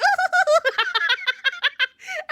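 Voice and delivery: very high delighted laughter; squealing laugh. Real, not acted. Laughing at BL fans meme.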